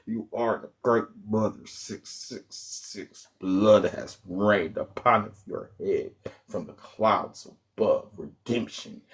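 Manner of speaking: low, slow, whispery dark-prophet voice; hammed-up occult reading, soft and breathy; spooky oracle voice. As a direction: intone it like an ominous seer. Continues mock oracle reading.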